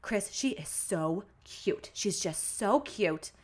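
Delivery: slow, gushing Boston mom; accidental Boston accent. Retold or imitated. Quoting mom gushing to grandma Chris, slow Boston accent. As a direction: imitate a smitten mom with a Boston accent, drawn out. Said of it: "I just gave her a Boston accent".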